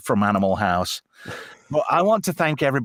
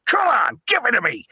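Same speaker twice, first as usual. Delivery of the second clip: high, pleading shout to the TV; mock eager plea; 10 semitones higher than usual. Man to TV demon, high excited begging. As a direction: beg the demon, all worked up.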